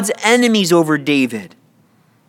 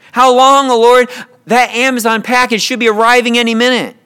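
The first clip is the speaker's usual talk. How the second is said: mock plea; 3 semitones higher, 3 dB louder, faster than usual. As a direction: parody an impatient prayer over a parcel. Parody of a trivial prayer.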